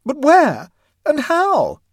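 loud, high, frantic cries; distracted. A narrator's reading. Frantic mother demands answers about her child, distracted. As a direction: wail each question in desperation. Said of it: "asked the distracted mother".

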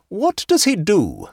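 stern aunt interrogating the hostess; magisterial. A narrator's reading. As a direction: press the point like a magistrate. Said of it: "pursued Missus Troyle magisterially".

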